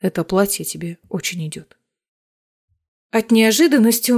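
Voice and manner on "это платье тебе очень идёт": softly and lower, gently; quiet. A narrator's reading. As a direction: say it quietly, almost to himself. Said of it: "он тихо произносит".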